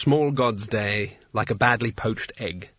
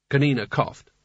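ordinary delivery

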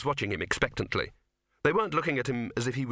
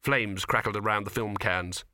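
ordinary delivery

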